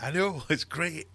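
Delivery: slow, high, delighted tease; gleeful. Retold or imitated. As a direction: savour her misfortune. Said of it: "I'd be like".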